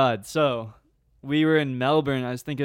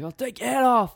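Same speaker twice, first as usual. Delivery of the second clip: high, loud Australian threat; mock Aussie threat; 10 semitones higher, 4 dB louder than usual. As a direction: play the furious driver. Retelling the angry driver.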